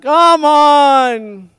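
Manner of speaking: booming giant taunt; loud taunt. Acted. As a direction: bellow across the valley. Goliath taunts daily.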